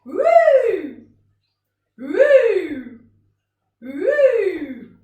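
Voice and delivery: loud whoop arching high, then falling; big swooping woo. Shown as a demonstration. Teacher following a tossed cushion, big loud swooping woo. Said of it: "bigger noise, slower".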